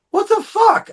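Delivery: very high shocked outburst; outraged disbelief. Retold or imitated. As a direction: squeak it out, stunned. Told he looked chubby.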